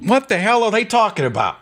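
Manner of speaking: incredulous, high and exasperated. Real, not acted. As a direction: throw up your hands in disbelief. Exasperated outburst after reading.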